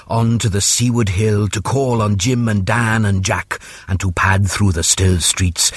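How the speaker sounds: usual speaking voice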